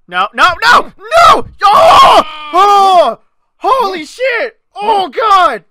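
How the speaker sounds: high, loud rising panic; panicked shouting. Real, not acted. Gamer yelling as the character falls. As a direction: shout as it all goes wrong.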